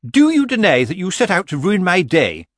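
high, loud indignation; furious accusation. Acted. Missus Earwig accuses Granny of ruining her day, furious.

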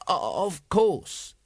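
forcing the words out, strained; barely managing to speak. A narrator's reading. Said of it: "he managed".

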